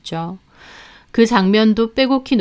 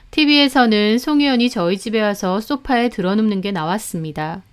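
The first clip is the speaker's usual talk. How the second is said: ordinary delivery, nothing marked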